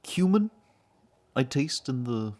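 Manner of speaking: high-pitched halting voice with long pauses; Peter Gregory. Retold or imitated. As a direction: pitch it up and space the words out. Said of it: "you remember Peter Gregory from".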